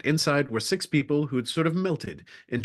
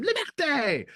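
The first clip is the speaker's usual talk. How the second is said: much higher rallying cry of Liberté!; mock scream; slower than usual. Forty passengers in the hall greeting each new door, a mock crowd scream. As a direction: shout Liberté! on cue like a crowd. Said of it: "everyone would scream".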